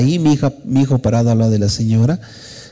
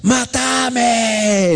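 very high, long-held desperate cry; stretched-out high wail; retold scream to God; 13 semitones higher than usual. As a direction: imitate screaming to heaven, without much volume. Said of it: "le he gritado a Dios".

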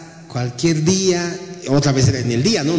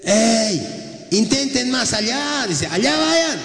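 calling out; 8 semitones higher, much slower than usual. Acted. Jesus calls from the shore.

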